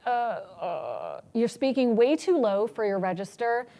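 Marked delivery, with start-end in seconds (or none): 0.0-1.2 creaky, guttural uh sounds; vocal fry demo. Shown as a demonstration. Lecturer demonstrating vocal fry to doctors. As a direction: croak it down low in the throat. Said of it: "Guttural, so it's when you're like".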